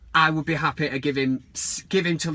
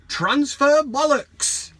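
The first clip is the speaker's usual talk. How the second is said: loud, slow and much higher, bellowed title; mock grand title; 10 semitones higher, 5 dB louder, much slower than usual. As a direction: belt it like a jingle.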